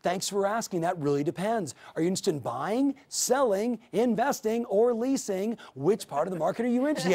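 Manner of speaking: lifted, upbeat client-friendly delivery; engaged, interested reply. Shown as a demonstration. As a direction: raise each option high as an inviting question. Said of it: "That's different from".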